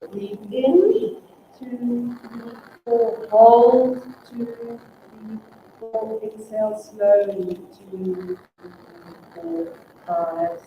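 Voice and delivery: counting breaths, exhales fading to unvoiced; guided breathing count. Leads a counted breathing exercise.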